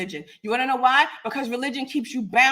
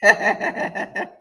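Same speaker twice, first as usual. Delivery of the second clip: breathy laugh; 3 dB louder than usual. Real, not acted. Laughs again before speaking.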